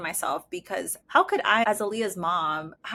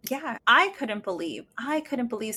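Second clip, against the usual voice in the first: normal talking voice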